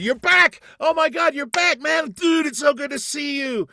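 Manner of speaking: loud, high, overjoyed greeting; excited shout. Retold or imitated. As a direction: shout it like seeing an old friend again. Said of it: "he's going".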